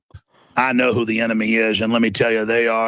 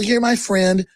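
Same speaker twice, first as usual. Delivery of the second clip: naive gazelle voice; 10 semitones higher than usual. Retold or imitated. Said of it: "He's feeling kind of powerful".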